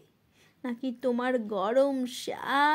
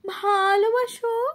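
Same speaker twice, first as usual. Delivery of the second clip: high, loud, rushed panic into a long cry; flustered calling out; 8 semitones higher, 6 dB louder than usual. Acted. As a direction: blurt it in alarm, then call out. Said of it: "শশব্যস্ত হয়ে তোমাকে ডাক দিয়ে". (শশব্যস্ত হয়ে উঁচু গলায় ডাক)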